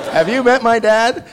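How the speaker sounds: put-on boy raving about his dad; eager kid. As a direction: sell his dad to mom. Repeating the kid's punchline.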